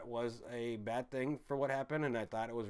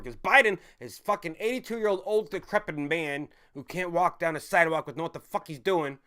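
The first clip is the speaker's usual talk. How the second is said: angry; 4 semitones higher, 7 dB louder than usual. Real, not acted. Angry rant at president.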